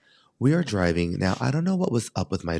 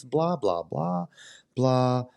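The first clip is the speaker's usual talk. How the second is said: impatient blah blah; 4 semitones higher, much slower than usual. Real, not acted. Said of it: "Get to the point here".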